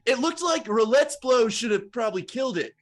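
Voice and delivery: loud, high-pitched crowd shout; second heckler's yell. Acted. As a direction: call out a loud objection from the audience. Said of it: "And another one yells out".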